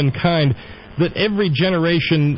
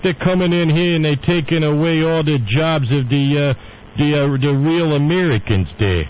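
a lightly put-on nativist New Yorker accent, raised in pitch on "They're coming in here"; mock old New York accent. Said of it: "Okay, close enough".

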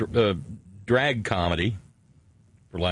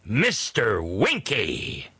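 drawn-out mock yell, no real volume; imitated movie yell, slow and held back; Jim Carrey yelling; much slower than usual. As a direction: stretch both words in a swooping imitation of a yell. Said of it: "and Jim Carrey yelling".

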